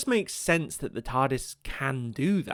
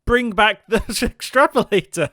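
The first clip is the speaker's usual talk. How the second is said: high, loud shouted plea; mock fan demand; 7 semitones higher, 8 dB louder than usual. Fan demanding a gadget's return, hammed-up high shout. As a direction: yell it like an overexcited fan.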